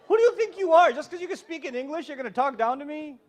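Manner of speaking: shouted at first, then settling into an offended scold; a parent yelling back at a child who talks down to them; indignant parent. Retold or imitated.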